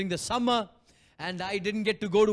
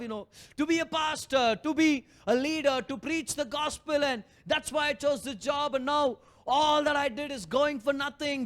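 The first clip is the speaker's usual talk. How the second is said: raised, aggrieved complaint to God; self-pitying complaint; 7 semitones higher than usual. Retold or imitated. Preacher retelling complaining to God about his sacrifice, aggrieved. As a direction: list your sacrifices, feeling wronged.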